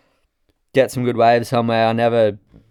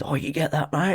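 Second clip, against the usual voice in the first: raised, soft mock-friendly surfer voice, slightly put on; imitating the surfer; 7 semitones higher, 6 dB quieter than usual. Photographer mimics a surfer asking for his shot, lightly put-on voice.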